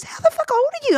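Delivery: high, amazed question; mock shocked disbelief; astonished. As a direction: blurt it out in disbelief. Retold astonished question from artist.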